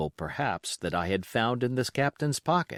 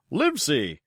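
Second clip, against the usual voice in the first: squire's voice; 4 semitones higher, 4 dB louder than usual. A narrator's reading.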